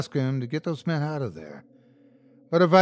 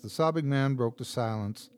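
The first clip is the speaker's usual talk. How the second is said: usual speaking voice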